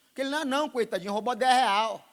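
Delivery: mock-sorry voice for the petty thief; mock pity. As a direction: sneer at the small thief with fake sympathy.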